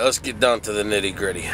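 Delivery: ordinary talk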